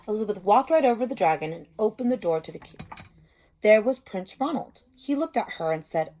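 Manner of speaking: lively read-aloud to a class; a teacher's picture-book reading voice; story narration. A narrator's reading.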